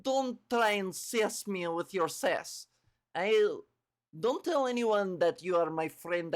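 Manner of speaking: slow, put-on Russian accent; cheesy emo loner with a Russian accent; Magic. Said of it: "she's, like, Russian".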